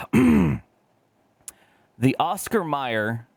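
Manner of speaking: slow, drawn-out product name; mock ad announcer, slow; dramatic reveal. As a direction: announce it like a grand unveiling.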